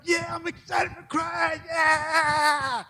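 high, slow, hammed-up shout of joy; overexcited cheer. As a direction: shout it like an overeager believer running off. Acting overexcited runner.

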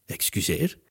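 taken-aback question. A narrator's reading. Narrator's hero reacts to news of the paper, taken aback. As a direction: softly ask in disbelief. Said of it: "deze keer iets luider".

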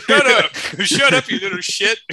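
put-on high, loud, laughing old man snap; cranky grandpa. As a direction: play a grumpy grandpa, laughing.

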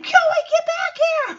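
high mock yell after him; hammed-up kids yelling; mock scream. Kids yelling after Joey in a high mock scream. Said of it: "They're all screaming".

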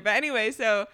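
ordinary talk

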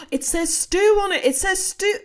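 ऊँची और ज़ोरदार, खोज की ख़ुशी में; जैसे कोई राज़ खुल गया हो; उत्साहित खोज. Retold or imitated. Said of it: "and he goes, gasps".

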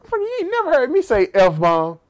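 loud, high mock outrage; indignant comeback. Answering whoever scolded him about F-bombs, indignant. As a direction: fire back in hammed-up indignation.

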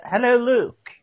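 ordinary delivery

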